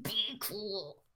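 hushed warning through clenched teeth. Retold or imitated. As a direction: keep the jaw shut and say it very softly. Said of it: "clenched teeth all the way".

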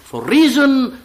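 rising oratorical recital; scripture-like reading. Acted. Reader recites the prophet's answer to the priestess, oratorical. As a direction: declaim it like a prophet. Reads The Prophet passage aloud.

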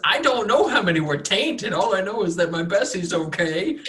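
nervous farmer. Acted. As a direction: stumble over words, eager to please. Farmer NPC answers.

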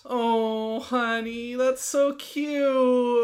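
high, drawn-out doting coo. Real, not acted. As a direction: gush over something adorable. Coos at friend's childish idea.

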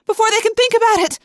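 very high, loud shout; frantic rallying cry; urgent yell. A narrator's reading. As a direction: shout it on the run. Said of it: "I yelled".

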